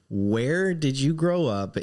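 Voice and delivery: usual speaking voice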